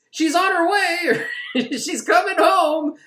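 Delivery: high, laughing fanfare voice; laughing mock announcement. Host mocking the mummy coming home, laughing high. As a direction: cackle it out like a giddy herald.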